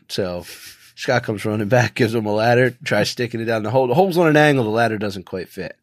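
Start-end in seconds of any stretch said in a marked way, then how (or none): none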